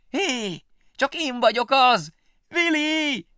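high, loud, slow calling out; shouting. Acted. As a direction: shout after the fleeing family. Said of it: "hangosan kiabálni kezdett".

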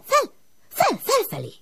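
fel fel shooting very high; soaring call upward. A narrator's reading. The smoke calling Ilus up the chimney, rising very high. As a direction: let fel fel rise like smoke.

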